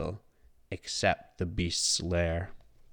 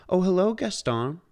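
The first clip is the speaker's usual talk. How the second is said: put-on bright, higher young woman; sweet girl greeting a suitor; lightly voiced heroine; Belle's voice; 10 semitones higher, 4 dB louder than usual. A narrator's reading. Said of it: "Belle replied".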